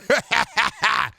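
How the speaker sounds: high burst of laughter; laughing. Real, not acted. Laughs at pie face.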